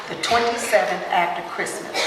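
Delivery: "twenty-seventh after Christmas" spoken as one even phrase, weight on "twenty-seventh"; shown date phrasing. Shown as a demonstration. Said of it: "Now notice how I said that".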